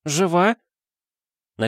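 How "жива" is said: high woman's anxious question; very high, urgent asking; anxious cry. A narrator's reading. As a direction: call out high, desperate for an answer. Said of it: "крик Герды Эриковны".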